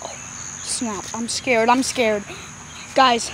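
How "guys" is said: normal talking voice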